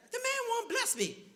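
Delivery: high, slow, whining complaint; whiny protest. Retold or imitated. Pastor whining back at his doubting friends, high and slow. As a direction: sulk it out in a high whine.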